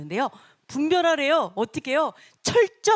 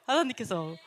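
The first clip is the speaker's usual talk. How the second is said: ordinary talk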